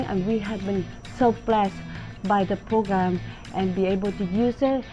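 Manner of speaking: normal talking voice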